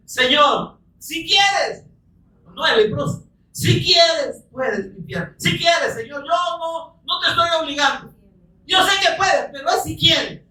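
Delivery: high, pleading appeal to the Lord; high-pitched pleading quote of a blind man; pleading cry. Acted. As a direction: beg him, voice lifted high. Preacher acts blind man's plea.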